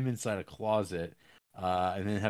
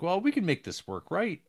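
mock-earnest apology, raised pitch; pleading lover; 4 dB louder than usual. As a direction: coax her back, lightly hammed. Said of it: "he says".

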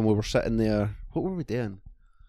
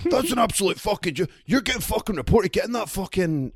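much higher, sing-song indignant rant; shrieking put-on outrage, retold; mock raging officer; 10 semitones higher than usual. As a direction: ham up the officer's fury.

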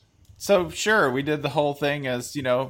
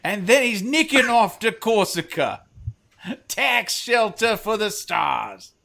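higher put-on European pundit accent; slow, hammed-up accent on the last bit; mock accent; 6 semitones higher, slower than usual. Host spinning a mock pundit tale in a put-on accent. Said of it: "lead immediately to that accent".

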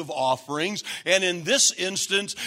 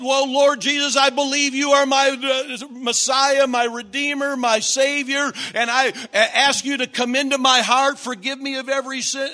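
higher, louder rattled-off prayer; mock altar prayer; 6 semitones higher, 5 dB louder than usual. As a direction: parody a rote recited prayer. Mocking a rote sinner's prayer.

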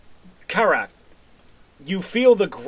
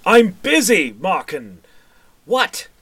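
higher, louder, snapping from behind a door; irritated shout; 4 semitones higher, 7 dB louder, slower than usual. Acted. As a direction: bark at the guard for interrupting. Mayor yelling behind a door.

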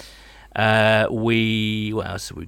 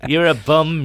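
slightly raised mock American drunk voice; slurred, louder American drunk heckle; drunken American; 4 semitones higher, 5 dB louder, slower than usual. Podcast host mimicking his own drunken self, American drunk shouting. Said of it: "weird American drunk slurring, shouting".

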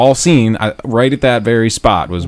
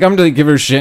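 ordinary talk, nothing marked

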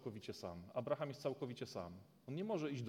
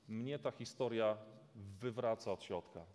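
ordinary talk

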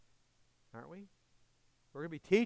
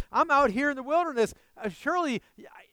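higher, louder, mock-pleased with himself; higher, louder voice of a smug wanderer; smug self-assurance; 14 semitones higher, 17 dB louder than usual. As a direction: play the believer sure he's fine. Voicing a complacent self-satisfied believer.

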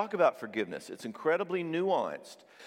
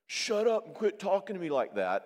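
higher-pitched, mock angry retort; jerk snapping back; 7 semitones higher than usual. Spouse being a jerk back, mock angry. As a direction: snap it like a jerk. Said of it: "is being a jerk back".

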